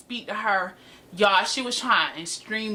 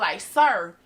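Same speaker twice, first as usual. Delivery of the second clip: usual speaking voice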